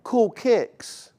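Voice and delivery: high put-on kid voice, laid back; imitating a teen. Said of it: "They'd be like".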